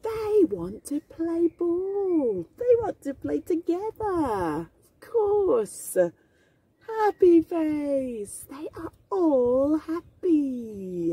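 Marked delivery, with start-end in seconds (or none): none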